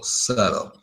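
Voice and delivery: subtle with a silent B, SUT-el; correct pronunciation. Shown as a demonstration. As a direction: drop the B in subtle. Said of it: "Don't pronounce the B".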